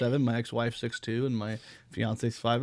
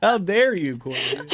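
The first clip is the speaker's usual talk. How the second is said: mock outrage; 9 semitones higher, 11 dB louder, slower than usual.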